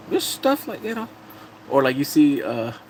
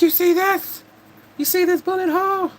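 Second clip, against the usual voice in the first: high-pitched, loud theatrical showing off; dramatic boasting; 4 dB louder than usual. Acted. Man showing off a bullet hole, hammed-up and high. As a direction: play an insistent braggart demanding attention. Acted storyteller showing off clothing.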